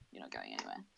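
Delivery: low, dark menacing threat; menacing villain line. Retold or imitated. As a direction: growl it like a captor. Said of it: "and he's like".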